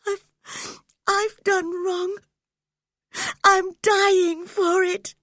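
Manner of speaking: high, loud, breaking with sobs; sobbing. A narrator's reading. Dying Catherine confessing to Heathcliff, sobbing. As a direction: choke the words out through tears. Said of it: "sobbed Catherine".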